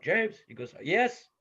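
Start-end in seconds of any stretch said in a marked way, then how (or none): 0.0-0.4 JAMES called out high and loud; dad shouting up to his son; yelling upstairs. Retold or imitated. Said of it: "And so I just yell up". 0.8-1.3 Yes called out high and loud; mock yell back. Son yells back from upstairs.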